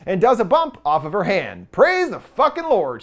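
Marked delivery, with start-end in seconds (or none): 1.7-3.0 mock praise, higher and drawn out; slow, lifted line with imitated sarcasm; sarcastic retelling. Recapping the heroine's last jab at religion, mock-sarcastic. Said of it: "she says sarcastically".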